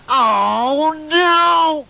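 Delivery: high loud oh no; horrified at the crunch; dismayed cry. Acted. Boy finds his model crushed, loud dismay. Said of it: "It's sat on my Thunderbird".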